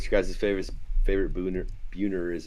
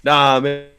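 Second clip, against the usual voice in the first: louder, higher mock brush-off; imitating a player; 6 semitones higher, 8 dB louder than usual. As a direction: toss it off like a ballplayer. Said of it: "and then he's like".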